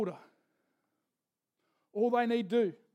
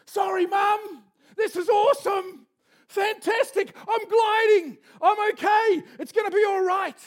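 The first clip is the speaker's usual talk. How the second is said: high, loud joyful cries; thrilled shouting eaglet; 11 semitones higher, 10 dB louder than usual. Acted. Eaglet gliding for the first time, shouting with joy to mum.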